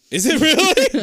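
high, loud laughing question; laughing disbelief. Real, not acted. Co-host reacts to the shaking, laughing in disbelief. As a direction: burst out laughing as you ask.

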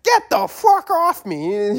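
high, slow mock snarl; angry skier. The angry skier shoving the kid off, in a high mock voice. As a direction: ham up the skier's anger. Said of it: "And he's like".

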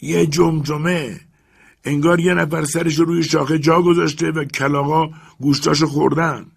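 servant reporting the skull. Acted.

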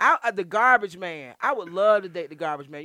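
loud comic proclamation; loud tongue-in-cheek announcement; loud declaration. Real, not acted. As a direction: proclaim it for everyone to hear.

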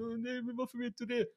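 put-on high, confused child voice; scared little kid. Boy reenacting his younger self questioning the teacher. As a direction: ask it like a frightened kid.

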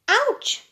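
high yelp, finger pricked; a sudden high "Ouch!"; sharp cry of pain. Acted. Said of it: "the princess cried".

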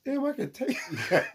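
high, laughing through the quote. Retold or imitated. Retelling the skit's punchline to a friend, laughing. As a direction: giggle the line out high. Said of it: "I said".

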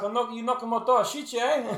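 loud, high South African caricature; mock South African accent. Mimicking the film's heroine, exaggerated South African accent. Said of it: "Nobody talks like that".

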